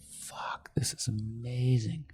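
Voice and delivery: hushed, breathless whisper of amazement; retelling a stunned customer's whispered reaction; awed whisper. Retold or imitated. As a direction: whisper it like you can't believe your eyes. Dude tries the glasses, awed.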